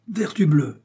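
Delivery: high, indignant exclamation; cried-out oath. A narrator's reading. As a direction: cry out an oath in surprise. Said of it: "cria Porthos". (juron crié, voix aiguë)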